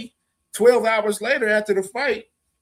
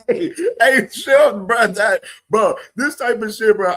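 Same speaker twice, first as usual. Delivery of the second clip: laughing call out; 2 semitones higher, 6 dB louder than usual. Real, not acted. Streamer calling to a chatter, laughing loudly.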